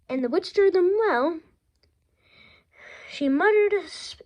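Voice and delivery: ordinary delivery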